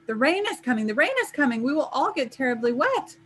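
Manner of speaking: high-pitched, agitated hornet chatter. A narrator's reading. Hornets warning each other of coming rain, high agitated cries. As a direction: raise the pitch as the swarm panics. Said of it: "The hornets buzzed louder".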